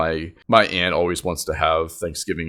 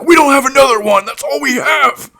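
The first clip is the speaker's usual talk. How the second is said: fast, high, loud comeback; mock angry retort; 8 dB louder than usual. Uncle argues over the only turkey, mock angry shout. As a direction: imitate someone yelling back, exasperated.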